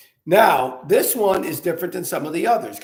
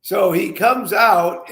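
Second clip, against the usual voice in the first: ordinary talk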